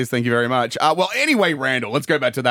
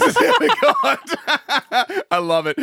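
higher, words breaking into laughter; real laughter; 13 semitones higher, much slower than usual. Real, not acted. Podcaster delighted at loosest episode ever, really laughing. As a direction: laugh helplessly while talking. Host cracking up.

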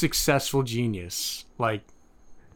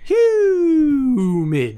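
high falling holler; drawn-out show-name call; 9 semitones higher, 5 dB louder than usual. Pretend. Cohost crying out the show's joke name, drawn-out holler. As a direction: stretch it out loud, sliding down from the top.

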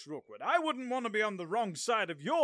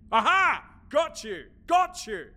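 louder, triumphant gotcha; 7 semitones higher, 8 dB louder than usual. Acted. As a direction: crow in triumph. King catches the prisoner out.

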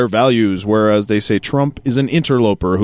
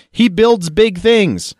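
high, slow, wide-eyed; a dreamy, pitched-up imitation of Palin; slow, gushing Sarah Palin parody; mock Palin quote; 8 semitones higher, slower than usual. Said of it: "she said".

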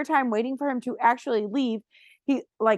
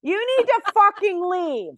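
louder, higher, fed-up snap; angry retort; 5 semitones higher, 5 dB louder than usual. Retold or imitated. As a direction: tell him off to his face. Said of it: "And I go".

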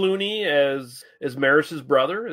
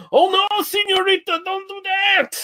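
very high, squeaky cartoon servant; a hammed-up falsetto señorita plea; comedy Mexican servant. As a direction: parody a stock comic-relief Mexican character. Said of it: "comedy Mexican".

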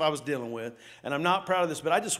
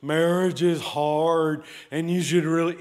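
mock preachy sermon voice; 4 dB louder than usual. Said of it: "preachers that preaches".

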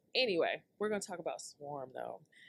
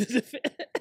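laughing while reading; 7 semitones higher, 8 dB louder than usual. Real, not acted. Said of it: "I gotta stop laughing".

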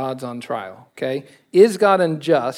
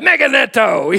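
Meganeto shouted out in a loud, higher Greek voice; Greek yell; 7 semitones higher, 6 dB louder than usual. Retold or imitated. Preacher voicing Paul yelling Greek at the listener, mock shout. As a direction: belt out Meganeto like an angry old Greek. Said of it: "old Greek speaking Paul yelling".

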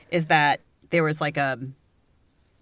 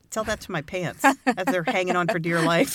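ordinary talk

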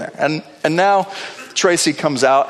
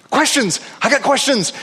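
excited inner voice; 8 semitones higher than usual. Retold or imitated. His frantic inner thought.

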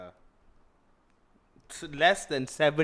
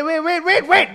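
excited interruption; 11 semitones higher, 8 dB louder than usual. Real, not acted. Interrupting loudly.

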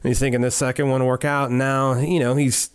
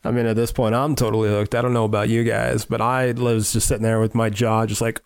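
ordinary talk, nothing marked

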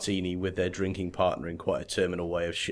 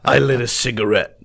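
deadpan film noir voiceover; noir detective; 5 dB louder than usual. Pretend. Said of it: "that's proper film noir".

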